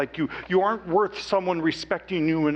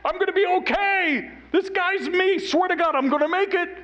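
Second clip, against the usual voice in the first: much higher and louder, ringing out to the whole room; joyful yell; 3 dB louder than usual. Retold or imitated. Mark wanting to shout to the room, triumphant loud yell. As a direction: proclaim it to everyone, triumphant. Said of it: "I want to stand up in the room and yell".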